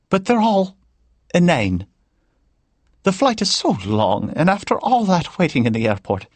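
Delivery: put-on high Irish old woman's voice, pitched well up; a chatty Irish grandmother complaining lightly; Irish lilt of an older woman; Irish accent. A narrator's reading. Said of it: "in an Irish accent".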